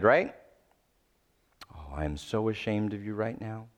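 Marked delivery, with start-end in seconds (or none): 1.7-3.7 low, softer disappointed parent; a hint of a shaming parent's lecture; hushed scolding parent voice; lecturing parent. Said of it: "the lecture method".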